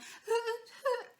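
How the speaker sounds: high, cracking sung wail. Shown as a demonstration. Singing teacher imitates a struggling student, high cracking voice. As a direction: demonstrate a note breaking up high. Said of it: "it's starting to crack".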